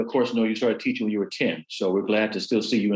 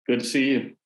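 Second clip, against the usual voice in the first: ordinary talk, nothing marked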